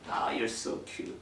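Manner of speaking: very slow, soft, breathy-sweet; affectionate voice. Shown as a demonstration. Parent cooing to a child, slow and soft. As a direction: coo it tenderly. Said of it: "애정적인 목소리".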